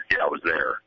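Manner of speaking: low deadpan manager repeating himself; Jim Leyland impression. Host doing Leyland brushing off a reporter, low and deadpan. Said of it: "a little more of that".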